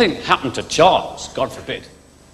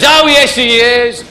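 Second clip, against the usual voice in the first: high, loud mock panto shout; playful pantomime heckle; audience shouting out; 7 semitones higher, 6 dB louder than usual. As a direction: yell it like a panto crowd. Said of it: "audience shouting out".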